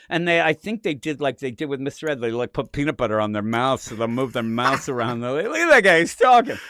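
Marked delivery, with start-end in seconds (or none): 5.5-6.6 high, loud silly talking chimp; goofy cartoon dub, pitched way up; dubbed monkey voice. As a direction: imitate a badly dubbed chimp. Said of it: "then they dub in voices".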